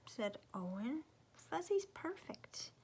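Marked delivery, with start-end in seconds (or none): 1.5-2.7 put-on fond child. Owen defends his blanket.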